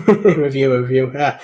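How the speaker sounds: real chuckle running into the words; laughing at a muddled phrase; amused laugh. Real, not acted. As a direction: say it through a chuckle. Amused at stumbling over a comment.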